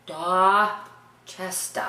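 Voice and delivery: DORCHESTER very slowly as "Dah... ches-tah". Shown as a demonstration. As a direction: break DORCHESTER in two, no r's. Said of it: "No R's".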